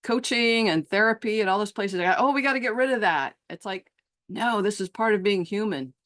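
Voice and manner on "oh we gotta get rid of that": high, fast mock alarm; hammed-up hurry to fix it; mocking fix-it urge. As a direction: parody an anxious therapist.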